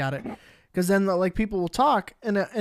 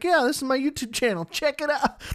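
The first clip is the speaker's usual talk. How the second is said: self-promoting; 6 semitones higher than usual. Retold or imitated. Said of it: "shameless self-promotion stuff".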